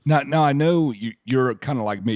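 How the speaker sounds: usual speaking voice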